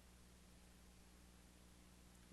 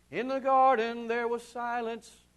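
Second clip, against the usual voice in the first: normal talking voice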